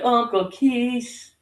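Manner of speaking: put-on high, drawn-out little-girl squeal of thanks; gushing kid calling her uncle; imitated happy girl. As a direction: stretch it out sweetly, way up high. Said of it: "She's gonna be like".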